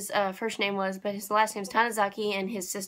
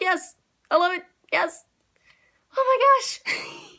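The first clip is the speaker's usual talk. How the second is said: thrilled gush; 9 semitones higher, slower than usual. Retold or imitated. Thrilled by serious turn.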